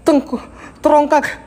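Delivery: scared so the word won't come out right; flustered stammer. Real, not acted.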